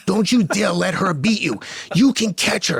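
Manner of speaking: high, intense trackside exhortation; urgent coach urging. Retold or imitated. Coach to runner near the finish, urgent and insistent. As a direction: push the runner to the line.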